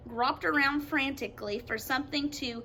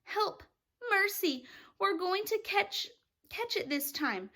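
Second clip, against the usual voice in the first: higher panicked plea; 7 semitones higher than usual. Acted. Travelers facing the storm cloud, high panicked plea. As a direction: beg for mercy in panic.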